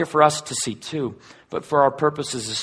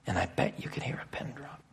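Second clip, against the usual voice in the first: hushed, softer, breath only; whisper; 4 semitones lower, 15 dB quieter than usual. Acted. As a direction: whisper it, as if the room went silent. Silence after the foot washing.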